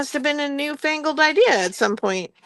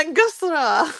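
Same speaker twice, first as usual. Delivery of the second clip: higher, playful mock-French attempt; French accent; 5 semitones higher than usual. Shown as a demonstration. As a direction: try it with a French accent, amused. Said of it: "Just say it with a French accent".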